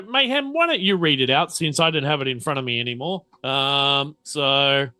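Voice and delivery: ordinary talk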